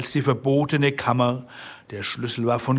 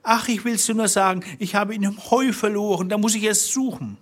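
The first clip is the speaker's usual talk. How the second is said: high, hurried anxious excuse; flustered wife; 9 semitones higher, faster than usual. Acted. Wife making excuses to Bluebeard, flustered and high. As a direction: plead in a high, nervous wife's voice.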